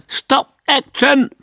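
high, loud, drawn-out command; supervisor's shout. A narrator's reading. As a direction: yell it across the set, stretching each word. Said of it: "Plötzlich schrie der Supervisor". (laut geschrien wie ein Supervisor)

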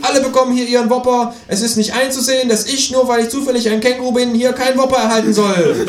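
high-pitched tirade, rising in outrage; outraged, high kangaroo protest; indignant kangaroo. A narrator's reading. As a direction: rant about your rights in a high voice. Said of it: "schnaubt das Känguru".

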